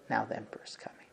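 soft whispered quiet certainty; hushed whisper. Retold or imitated. Rav Yosef quietly telling them in the silence, soft whisper. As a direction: whisper it like a calm secret.

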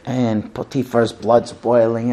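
ordinary talk, nothing marked

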